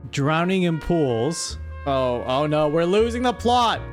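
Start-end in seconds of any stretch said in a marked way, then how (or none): none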